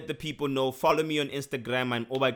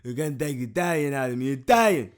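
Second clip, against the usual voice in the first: a hammed-up, lightly put-on Italian accent, louder on Italian; playing a bad Italian accent for laughs; mock Italian accent; 3 semitones higher, 7 dB louder than usual. Cohost teased for sounding Italian, does a mock Italian accent. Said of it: "I'm trying with my, with my Italian".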